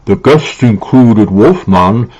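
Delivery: slow, exaggerated horror-actor impression; Boris Karloff. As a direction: draw it out with swooping pitch, hammy and theatrical. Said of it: "Awful Boris Karloff impression".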